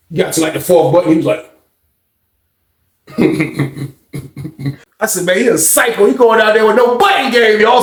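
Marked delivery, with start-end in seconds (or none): 5.3-7.8 amazed shout. Retold or imitated. Teller calling out to the pod, high amazed shout. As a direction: shout it like you can't believe it.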